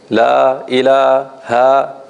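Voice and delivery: La ilaha stretched slowly, vowels held long and even; elongated recitation. Shown as a demonstration. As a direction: hold each long vowel in La ilaha for equal length. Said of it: "like this, they sound equal".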